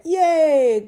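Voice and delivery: high, loud little cheer; excited cheer. Real, not acted. Trying out the bed in her new bedroom, a loud cheer.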